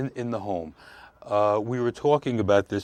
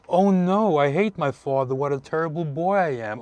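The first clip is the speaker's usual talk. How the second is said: distraught child, higher and louder, hammed-up; over-dramatized child; 5 semitones higher, 5 dB louder than usual. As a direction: play up a boy's horror at his own feelings. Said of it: "I'm over-dramatizing this".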